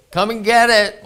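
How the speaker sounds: imitated yell. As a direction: mimic hollering someone in to eat. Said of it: "Debbie yells out at me all the time".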